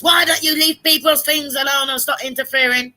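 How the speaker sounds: mock scolding, pitched high and drawn out; high-pitched, drawn-out imitation of telling someone off; retold scolding. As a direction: scold a meddler in a high, slow voice. Retold scolding of meddling people.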